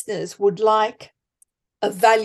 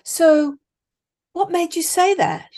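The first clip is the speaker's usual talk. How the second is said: lightly raised, gently curious asking; curious question; 7 semitones higher than usual. Shown as a demonstration. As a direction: sound genuinely interested, not probing. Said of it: "Ask questions".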